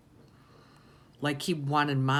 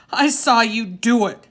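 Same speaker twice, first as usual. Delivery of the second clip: higher and louder, standing firm; loud defiant accusation; 8 semitones higher, 12 dB louder than usual. A narrator's reading. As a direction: throw it right in his face. Boy confronts father face to face.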